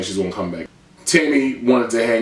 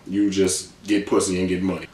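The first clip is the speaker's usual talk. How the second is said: normal talking voice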